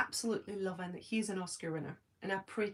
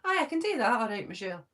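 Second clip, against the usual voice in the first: dad's Scottish; 2 semitones higher, 4 dB louder than usual. Retold or imitated. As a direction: imitate a Scottish dad, dropping down at the end. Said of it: "that's how my hus-, um, my dad talks".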